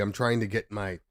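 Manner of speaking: ordinary talk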